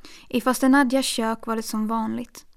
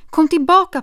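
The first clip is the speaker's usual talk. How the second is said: loud call, higher; 6 semitones higher, 11 dB louder than usual. A narrator's reading. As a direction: shout after him to come back. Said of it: "ropade hon". (ropar högt)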